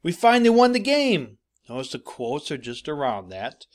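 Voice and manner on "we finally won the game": much higher and louder, triumphant shout; victory yell from the mound; excited yell. Shown as a demonstration. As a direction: shout it like the pitcher after winning. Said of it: "Yelled, that shows emotion".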